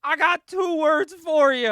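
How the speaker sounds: retelling a wrestler's mic hype to the crowd, slow and loud; building hype call. Retold or imitated. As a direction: drag it out like a ring announcer hyping the crowd. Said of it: "and he says".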